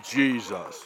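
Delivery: lightly put-on deep spokesman voice; mock deep voice. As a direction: borrow the deep ad voice for Jesus. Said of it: "I don't need that voice".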